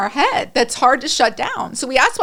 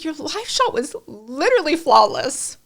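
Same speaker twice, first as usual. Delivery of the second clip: gushing praise; 4 semitones higher than usual. Retold or imitated. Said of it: "I'm like".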